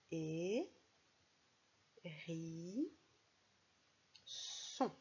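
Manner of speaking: hérisson split into Et, ri, son, each held; syllable by syllable. Shown as a demonstration. Teacher counts syllables of hérisson, slow split Et, ri, son. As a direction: sound out Et... ri... son with long pauses. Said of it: "comptons d'abord le nombre de syllabes".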